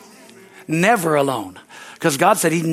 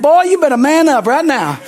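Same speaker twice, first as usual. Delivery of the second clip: self pep talk; 7 semitones higher, 6 dB louder than usual. Retold or imitated. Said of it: "I preach to myself in the mirror".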